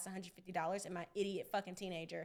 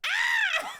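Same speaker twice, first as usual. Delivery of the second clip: very high, loud mock shriek; startled scream; 11 dB louder than usual. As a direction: shriek like a caught kid. Dad rises like Undertaker.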